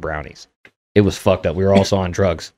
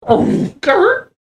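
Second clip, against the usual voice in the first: sudden high yelp of the name; startled yell, Kurt's name jumps up high; alarmed cry; 4 dB louder than usual. Retold or imitated. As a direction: shout his name in a startled panic. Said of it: "like, I'm".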